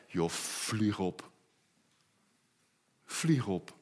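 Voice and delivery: zacht, traag en laag weggewuifd; laag afwijzend. Real, not acted. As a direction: wuif het minachtend weg. Spottend afwijzen. (laag en zacht minachtend)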